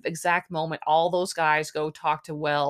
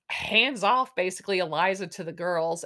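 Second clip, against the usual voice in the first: usual speaking voice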